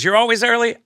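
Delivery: high, loud, incredulous "You're always early?"; surprised question. Retold or imitated. Acted quote, surprised question answered.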